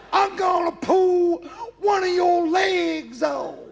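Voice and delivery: very high, loud, slow Southern drawl. Acted. Alabama man threatening the frog in his hand, high slow Southern shout.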